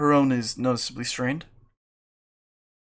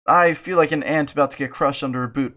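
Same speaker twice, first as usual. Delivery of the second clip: ordinary delivery, nothing marked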